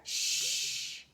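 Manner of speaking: sharp unvoiced shush; hissed shush. A narrator's reading. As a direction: hiss a warning shush. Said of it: "hissed a voice".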